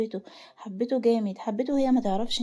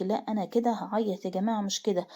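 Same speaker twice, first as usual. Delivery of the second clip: plain reading voice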